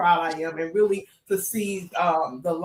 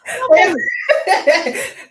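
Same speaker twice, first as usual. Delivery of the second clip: big high burst of laughter; loud laugh; 9 semitones higher, 8 dB louder than usual. Real, not acted. Host laughing loudly at the guest's modest answer.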